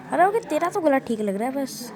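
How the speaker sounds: ordinary talk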